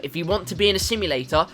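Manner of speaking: ordinary talk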